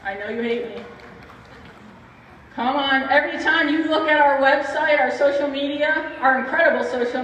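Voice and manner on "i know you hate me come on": ordinary talk, nothing marked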